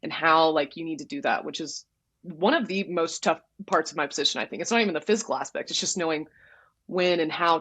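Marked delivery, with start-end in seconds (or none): none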